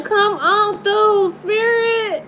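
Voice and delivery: high, slow, drawn-out plea; calling the spirit. Real, not acted. Tarot reader calling the spirit through, drawn out.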